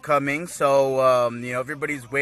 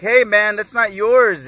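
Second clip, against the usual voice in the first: loud, higher-pitched objection; protesting kid; 7 semitones higher, 7 dB louder, slower than usual. Retold or imitated. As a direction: play a child protesting over a toy. Voicing Goten objecting to Trunks.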